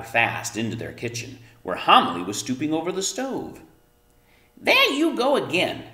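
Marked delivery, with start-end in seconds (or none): 4.6-5.8 high, loud cross mother voice; angry exclaim. A narrator's reading. Mother startled by rolling potato, loud cross exclaim. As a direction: snap at her, pitched up and loud. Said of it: "exclaimed Homily, turning angrily".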